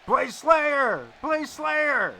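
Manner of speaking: high, loud mock scream at the band; half-joking shouted heckle; imitated screaming. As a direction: yell it like a rowdy heckler across a gig. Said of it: "start screaming".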